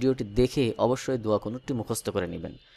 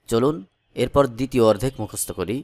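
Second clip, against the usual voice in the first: usual speaking voice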